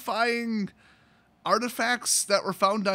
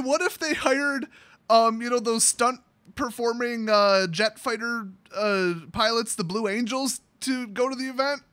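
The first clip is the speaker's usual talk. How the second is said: ordinary talk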